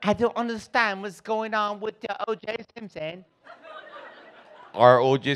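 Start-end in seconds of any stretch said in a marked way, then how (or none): 0.0-3.2 high, softer imitation English accent; mock English accent. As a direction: put on the English cousin, high and light. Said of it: "talk like that's weird".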